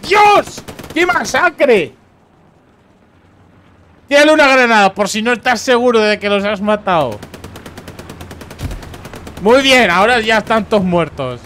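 high, loud shouting at the carnage; shocked outcry. Real, not acted. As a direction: cry out in disbelief at the slaughter. Horrified cheering at a massacre.

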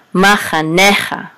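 "Ma cha necha" in loud, separated syllables, "ne" stressed; accent on "ne". Shown as a demonstration. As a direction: sound out "Ma cha necha" firmly, leaning on "ne". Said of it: "This is the accent right here".

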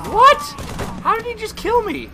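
very high, baffled voice; high-pitched astonished protest; incredulous squeak. Real, not acted. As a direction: squeak in disbelief. Baffled at being killed.

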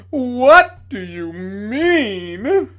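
high, loud, very slow challenge; indignant demand. A narrator's reading. Tragedian demanding an answer from the lady, very slow loud. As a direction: drag it out in wounded outrage.

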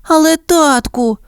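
put-on high, drawn-out childlike plea; pleading. A narrator's reading. Puppy Penny protesting to her father, pleading. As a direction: whine a plea to a parent, pitched high. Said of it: "благально мовила Пенні".

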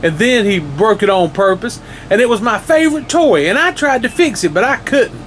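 high, fast, rising tearful rant, not plain tears; upset little boy lists his woes to the monster, rising and rushing; crying boy. Acted. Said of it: "the little boy cried".

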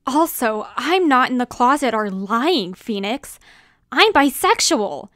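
loud, heated accusations; angry outburst. Acted. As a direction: lash out in a bitter argument. Hurtful break-up argument.

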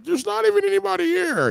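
mocking her. Streamer imitating the viewer, high mocking squeak. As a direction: squeak it like a whiny girl. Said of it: "She's like".

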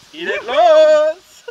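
loud, drawn-out cheer; excited shout. Real, not acted. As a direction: yell it out, slow and high. Jubilant start of sauna session. (begeisterter, gedehnter Jubelschrei)

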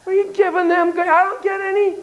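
high furious complaint about grapes; furious monkey. Retold or imitated. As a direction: protest like a shortchanged monkey.

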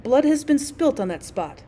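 ordinary delivery, nothing marked

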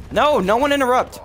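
high, loud, stretched yell; shouting at others to stay out of the duel; excited shout. Real, not acted. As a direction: warn everyone off loudly. Shouting during a one-on-one fight.

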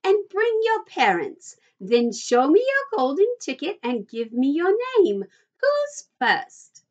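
high, fluty call to the crowd; fluty host leaping up in pitch; Willy Wonka. A narrator's reading. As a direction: call out instructions in a swooping high voice. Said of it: "he called out".

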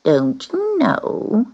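slow, high, sneering drawl; high, slow, scornful cat. Acted. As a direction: look down your nose at the newcomer. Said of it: "she sneered".

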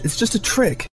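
a touch of stubborn anger; hard edge. Acted. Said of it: "her voice took on a hard edge".

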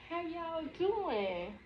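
sing-song slow high how y'all doing; high drawn-out greeting. Pretend. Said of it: "higher drawn-out greeting take".

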